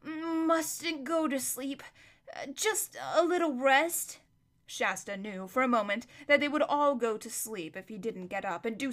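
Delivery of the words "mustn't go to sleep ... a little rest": slow, drowsy drawl; very slowly. A narrator's reading. Sleepy Bree drawls slowly to Hwin. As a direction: drag the words out half asleep. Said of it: "said Bree very slowly".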